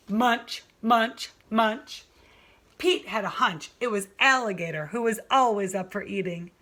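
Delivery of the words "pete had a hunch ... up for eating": ordinary delivery, nothing marked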